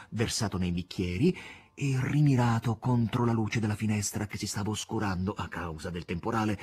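plain reading voice

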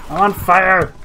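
loud, high panicked yell; alarmed shout. Real, not acted. Player burning in game, loud alarmed yell.

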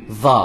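Za dengan hujung lidah di gigi; Arabic za sound. Shown as a demonstration. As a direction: sebut Za, lidah keluar sikit. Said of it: "dia lubik dulu". (sebutan huruf za)

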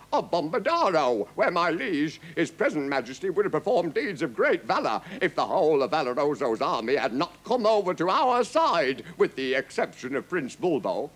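high, declaimed report; formal captain. A narrator's reading. Captain answering the furious king, declaiming. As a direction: report it boldly to the throne.

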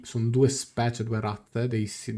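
ordinary delivery

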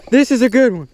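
ordinary talk

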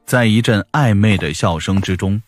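usual speaking voice

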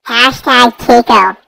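usual speaking voice

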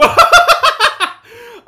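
very high, loud burst of laughter; shrieking laugh. Real, not acted. Player cracking up at his own crash, high loud laugh. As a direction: cackle helplessly at the mess.

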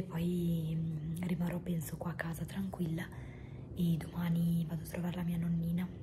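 hoarse breathy whisper; barely any voice left; voice giving out. Real, not acted. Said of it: "non ho più voce letteralmente".

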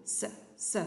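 long unvoiced hiss like a snake; teacher hissing the S for pupils, snake-like; hissing S sound. Shown as a demonstration. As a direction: hiss the S like the serpent. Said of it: "le S".

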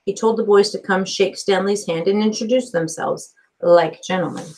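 usual speaking voice

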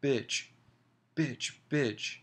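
enraged outcry; shouting. A narrator's reading.

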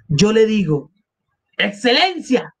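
high-pitched but unforced outcry; narrated cry. A narrator's reading. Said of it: "gritó". (grito agudo, sin forzar la voz)

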